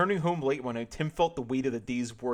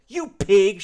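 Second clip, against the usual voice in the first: higher, loud outraged screech; 8 semitones higher, 13 dB louder than usual. Acted. Cashier screeching at George, loud outraged screech. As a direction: spit the insult at him. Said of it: "she screeched".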